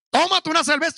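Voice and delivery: loud, high mock invitation to drink; mock permission. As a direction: ham up a careless preacher egging them on. Mocking what he won't tell believers.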